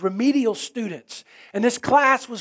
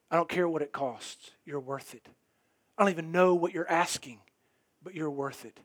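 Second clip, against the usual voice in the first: hushed sermon close; 6 semitones lower, 6 dB quieter than usual. Acted. Quiet intimate altar appeal.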